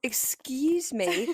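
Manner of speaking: breathy mock-shocked whisper. Real, not acted. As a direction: drop to a stunned whisper.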